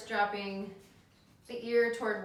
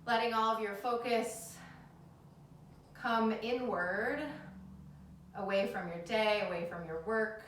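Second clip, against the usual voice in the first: calm them down into the breath; soothing yoga teacher; 3 dB quieter than usual. Acted.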